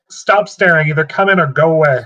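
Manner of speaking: calling out. Acted. Player's character confronts figure at the window, calling out. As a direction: call out a firm order to a lurker. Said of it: "she's gonna call out to him".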